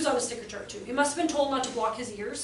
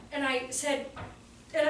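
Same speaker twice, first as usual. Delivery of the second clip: usual speaking voice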